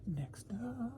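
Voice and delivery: breathy hushed cue; whispered next up. Real, not acted. Vlogger moves to next item, in a whisper. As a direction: whisper it, almost crying. Whispered after name call.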